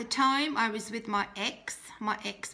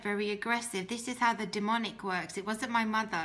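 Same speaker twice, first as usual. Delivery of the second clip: usual speaking voice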